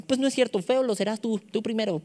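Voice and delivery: put-on high-pitched childish comeback; acted touchy retort in a high voice; mock defensive retort. Acting a childish retort to correction.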